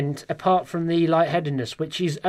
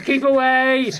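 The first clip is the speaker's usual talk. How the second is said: high-pitched comic yelp of a mum; mock alarmed warning; 9 semitones higher, 4 dB louder, much slower than usual. Mum shutting all the doors on son, mock alarmed warning. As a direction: imitate a panicky mum warding someone off.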